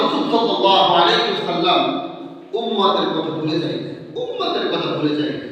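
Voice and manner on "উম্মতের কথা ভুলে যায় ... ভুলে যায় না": low, subdued repeat. Shown as a demonstration. Preacher dwells on the Prophet's care, low and subdued. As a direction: lower and softer, falling at each end. Spoken low before the call.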